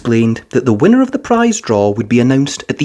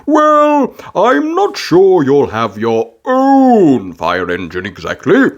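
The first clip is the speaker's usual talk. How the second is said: slow, gently doubtful dad; Daddy Pig voice; slower than usual. A narrator's reading. Daddy Pig correcting Peppa, gently doubtful.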